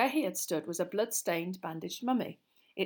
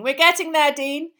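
loud, high urging call; rousing raised voice; teacher shouting; 8 semitones higher, 14 dB louder than usual. A narrator's reading. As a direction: shout encouragement across the class. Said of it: "shouted Lupin".

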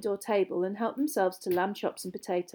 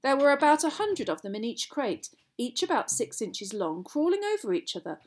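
usual speaking voice

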